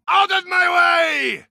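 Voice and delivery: high, forceful shout. Acted. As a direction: barge through yelling. Charging through.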